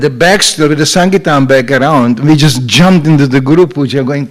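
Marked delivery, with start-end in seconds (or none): none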